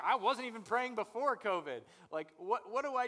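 high, loud imitated panic over prayer; mock panic. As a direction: play the lost believer, hammed-up alarm. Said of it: "you're like".

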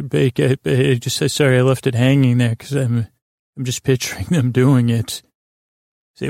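ordinary delivery

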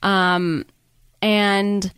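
ordinary talk, nothing marked

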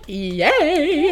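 high little celebratory yay; mock delight. Edith learns she got the job, mock delight. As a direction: act out giddy delight, laughing.

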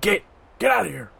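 high, sharp shout to chase off; yelling. Acted. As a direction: yell it out across the camp. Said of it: "but he's just yelling".

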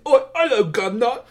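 exaggerated British accent, comic; hammed-up British accent. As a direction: overdo a Cockney-style British greeting. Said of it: "for, like, a British accent".

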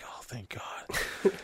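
slow, very soft whisper of relief. Retold or imitated. Relieved he is not going to jail, hushed whisper.

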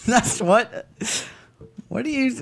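high, hammed-up New York accent; bad New York accent. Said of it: "I have a bad New York accent".

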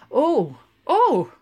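loud rising gasps; gasping oh. A narrator's reading. Linehan gasping as the barmaid stretches, loud gasps. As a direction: gasp at each stretch. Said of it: "gasping at each stretch".

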